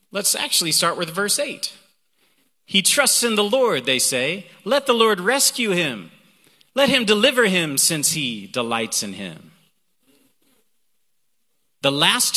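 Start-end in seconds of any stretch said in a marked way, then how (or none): 2.7-9.5 emphatic psalm reading. Acted. Preacher quotes the psalm's mockers, emphatic. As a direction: proclaim the verse, then let it settle.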